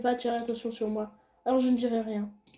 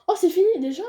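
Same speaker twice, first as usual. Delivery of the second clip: surprised; 7 semitones higher, 7 dB louder, faster than usual. Real, not acted. Game suddenly ends.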